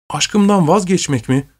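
a lightly voiced outcry of dismay; shocked cry. A narrator's reading. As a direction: exclaim it in disbelief, pitch shooting up. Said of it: "diye bağırdı Pusan".

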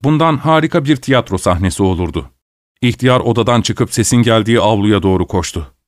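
usual speaking voice

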